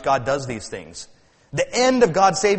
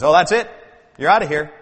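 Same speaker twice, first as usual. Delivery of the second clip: loud mock-stern pastor; acted, emphatic kicking-out; stern dismissal; 6 dB louder, faster than usual. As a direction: throw them out, acting fed up. Acting a fault-finding pastor expelling someone.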